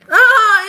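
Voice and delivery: loud, high burst of laughter; high laughing squeal. Real, not acted. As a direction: squeal with sudden amusement. Bursts into laughter while teasing.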